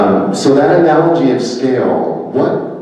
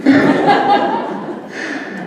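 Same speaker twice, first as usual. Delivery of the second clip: high, airy burst of real laughter; real amused laugh after the punchline. Real, not acted. Real laugh at his story.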